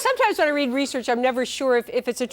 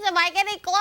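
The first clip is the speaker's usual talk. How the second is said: attempted Bobby voice; 6 semitones higher, faster than usual. Shown as a demonstration. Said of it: "you start talking like this".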